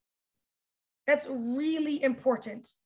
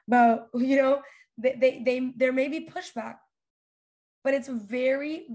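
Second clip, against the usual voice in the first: usual speaking voice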